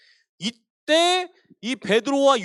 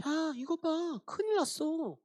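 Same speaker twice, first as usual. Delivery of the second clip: higher, much softer sly whisper; devil whispering; 6 semitones higher, 8 dB quieter, slower than usual. Acted. Devil whispering into believers' minds, sly soft whisper. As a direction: slip it in like a tempter's whisper. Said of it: "마귀가 이렇게 속삭이는 거예요".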